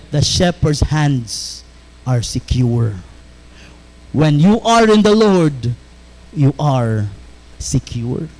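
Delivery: low tender reassurance to the congregation; reassuring hush. Acted. Quiet assurance after the verse.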